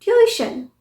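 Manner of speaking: ट्यूशन as "tyoo-i-shun", high start; correct pronunciation. Shown as a demonstration. Teacher demonstrates the hidden sound, ट्यूशन as tyoo-i-shun. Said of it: "य के बाद ई का भी sound".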